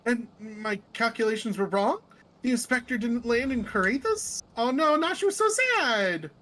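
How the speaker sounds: animated, rising lament; dismayed Nashu. Acted. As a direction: wail mildly in a character voice. Nashu is disappointed.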